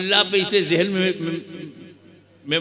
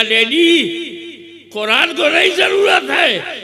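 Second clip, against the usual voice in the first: much higher and louder, shouted pulpit climax; fiery preaching shout; 13 dB louder than usual. Acted. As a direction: hammer the line home to the crowd. Son's answer preached in a shout.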